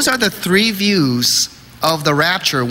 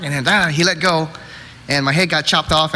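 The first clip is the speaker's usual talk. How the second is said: usual speaking voice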